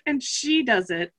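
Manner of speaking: ordinary talk, nothing marked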